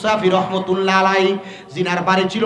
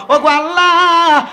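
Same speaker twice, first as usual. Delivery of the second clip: loud, high, drawn-out cry to God; imitated supplicant's soaring wail; retold tearful plea; 8 semitones higher, 6 dB louder, slower than usual. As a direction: call out imploringly, stretching the last vowel. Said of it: "কান্না করতে লাগলাম".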